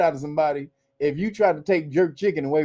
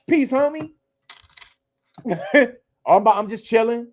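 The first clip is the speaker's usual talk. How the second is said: mock hip slang; 5 semitones higher than usual. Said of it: "and then it became".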